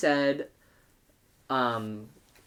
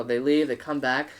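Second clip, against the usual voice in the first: usual speaking voice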